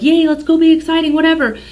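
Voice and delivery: mock excitement. Voicing the Aries new moon energy, mock excited. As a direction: ham up a giddy yay.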